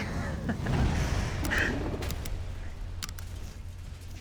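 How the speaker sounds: whispered evil cackle; wheezing villainous laugh; breathy villain laugh. Acted. Villain laughs after detonator.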